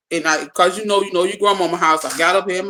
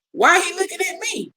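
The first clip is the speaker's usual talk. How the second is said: scared; 11 semitones higher than usual. Retold or imitated. Retold own scared thought.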